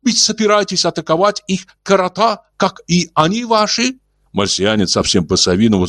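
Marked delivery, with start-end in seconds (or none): 0.0-4.0 gentle alien voice. Acted. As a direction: speak like a kindly old alien. Martian Ulin voiced.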